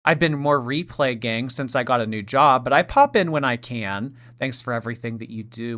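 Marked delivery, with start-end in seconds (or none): none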